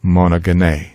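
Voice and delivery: "monogene" as mono-geh-NAY, hard g; accent the final syllable of "monogene": NAY; Greek pronunciation. Shown as a demonstration. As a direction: say "monogene" ending in an "ay" sound as in obey. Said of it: "is pronounced".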